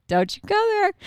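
high-pitched warning through a laugh; strained composure. Retold or imitated. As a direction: hold back with a tight, high little warning. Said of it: "trying to keep my calm and my cool".

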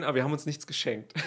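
normal talking voice

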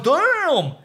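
high, exclaimed amazement; mock amazed viewer. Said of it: "and then you're like".

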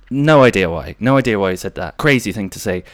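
ordinary talk

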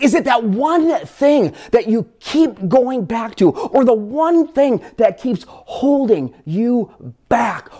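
slow, high, pleading build; urgent sermon plea, drawn out; impassioned preaching. Acted. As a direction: press the question home slowly. Preaching build at full force.

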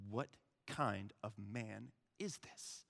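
soft, slow, amazed question; hushed awe. Acted. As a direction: marvel slowly under your breath. Awed disciples acted after the storm.

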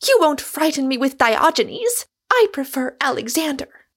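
high, loud cyclone of scorn; wounding tirade. A narrator's reading. Rosamond attacks Michael's ways and failures, furious wounding tirade. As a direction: let loose, speaking every word to wound. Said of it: "speaking every word to wound".

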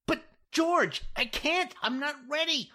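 very high, urgent pleading; panicked plea. Acted. Jack begging George not to leave him, panicked. Terrified, left alone with client.

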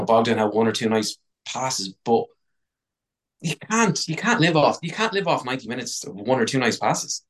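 usual speaking voice

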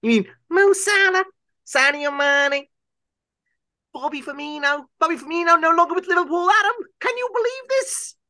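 very high Liverpool pundit impression; Jamie Carragher. Pundit aghast at a player leaving Liverpool, hammed-up Scouse impression. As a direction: squeal it as an incredulous Scouse pundit. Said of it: "It's the Jamie Carragher one".

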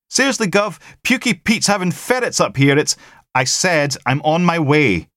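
high, loud voice of the caller; a colleague rattling off news down the line; caller on the phone. Acted. Constable calling Logan, high and urgent.

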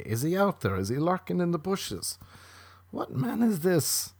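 high, hushed questioning; mock fearful searching. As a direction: play a woman nervously scanning the bushes. Acts woman scanning for killer.

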